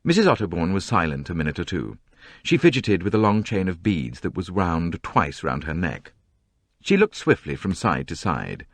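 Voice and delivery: ordinary delivery, nothing marked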